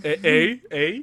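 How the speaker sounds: drawn out Canadian "eh". Pretend. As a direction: tease with a stock Canadian eh. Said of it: "don't talk like that, eh?".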